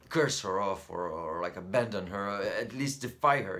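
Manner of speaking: loud, pitch climbing on the defiance; frustrated outburst. Acted. Son imagines cursing and abandoning his mother, frustrated outburst. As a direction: burst out with pent-up rebellion.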